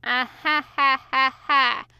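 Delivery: flat mock laughter, each ha deliberate; sarcastic fake laugh. A narrator's reading. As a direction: sneer out a sarcastic ha ha ha. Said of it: "Crowley sneered".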